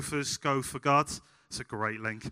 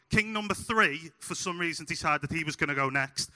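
ordinary delivery

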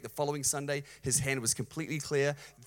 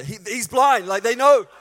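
loud, high comic incredulity; raised voice, hammering each phrase home; exasperated disbelief; 8 semitones higher, 11 dB louder than usual. Real, not acted. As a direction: shout the obvious to the congregation. Mock disbelief at an obvious question.

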